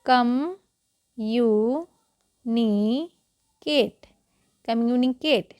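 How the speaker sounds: "कम्युनिकेट" split kam, yu, ni, ket, then joined; syllable-by-syllable. Shown as a demonstration. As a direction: sound out कम्युनिकेट in separated syllables. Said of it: "क्या पढ़ते हैं?".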